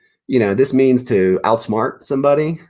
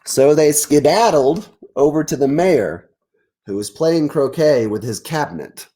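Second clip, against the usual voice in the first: lively read-aloud narration, a touch higher; story narration; 5 semitones higher, 3 dB louder than usual. A narrator's reading. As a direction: read it out like a picture book to kids.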